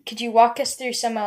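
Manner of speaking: normal talking voice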